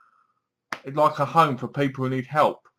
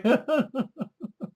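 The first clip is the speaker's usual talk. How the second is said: a short high incredulous laugh; brief high scoff-laugh; high mocking laugh; 11 semitones higher, 5 dB quieter than usual. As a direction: laugh at the absurdity. Mocking villain confession.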